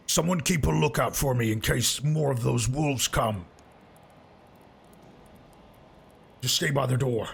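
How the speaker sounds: lightly voiced lookout-giving companion; a hint of a character voice, cautioning the party; Zal. Game master plays Zal.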